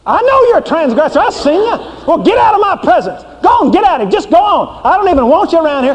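high, scornful God driving out a sinner; angry holy God. Acted. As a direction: play an offended God ordering a sinner out. Preacher plays God rejecting sinner.

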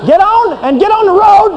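high, scornful God driving out a sinner; angry holy God. Acted. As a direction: play an offended God ordering a sinner out. Preacher plays God rejecting sinner.

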